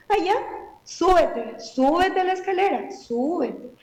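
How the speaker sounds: sarcastic, high-pitched dare to climb; daring the child, dripping with irony. Shown as a demonstration. Mother dares toddler to climb the stairs, sarcastic. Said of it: "estoy siendo sarcástica".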